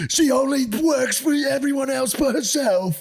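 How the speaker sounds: high, impassioned parody voice; mock hype guy. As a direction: overdo the earnest video rant. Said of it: "And he's like".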